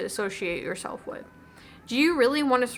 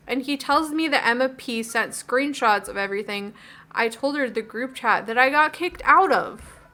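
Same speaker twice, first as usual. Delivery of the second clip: ordinary talk, nothing marked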